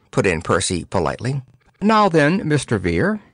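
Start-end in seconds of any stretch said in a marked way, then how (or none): 1.8-3.2 lofty high greeting; patronizing address to the poet; condescending. A narrator's reading. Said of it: "nodded condescendingly".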